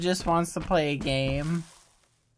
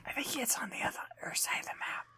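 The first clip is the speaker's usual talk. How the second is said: fast unvoiced whisper; 7 semitones higher, 16 dB quieter, faster than usual. Real, not acted. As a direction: whisper to stay hidden. Sneaking from monster.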